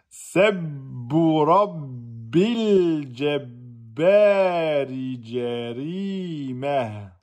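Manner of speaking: every syllable drawn out, very slow; slow motion. Shown as a demonstration. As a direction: stretch each sound like slow motion. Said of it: "je vous fais en slow motion". (au ralenti, chaque syllabe étirée)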